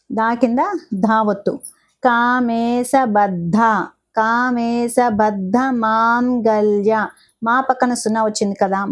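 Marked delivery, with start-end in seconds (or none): 2.0-6.9 కామేశబద్ధ మాంగళ్య drawn out slowly; slow careful recitation. Shown as a demonstration. Teacher reciting the name slowly for learners. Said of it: "మాం అన్నప్పుడు రెండు పెదాలు ఇలా కలవాలన్నమాట".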